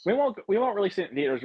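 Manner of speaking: high-pitched mock concession; mock studio response. Imitating them after the threat, in a high mock-placating voice. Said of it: "And they were like".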